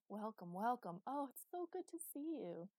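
effusive greeting, rising high; gushing welcome. A narrator's reading. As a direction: coo with delight at your guests.

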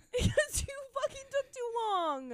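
high, tearful wail; panicked blame. Real, not acted. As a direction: wail the accusation in near tears. Panicked blame over lost bug.

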